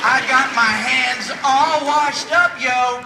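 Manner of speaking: slow, loud, high-pitched crowing; celebratory boast. Acted. Hospital worker celebrating washed hands, loud triumphant boast. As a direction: shout it like a big victory.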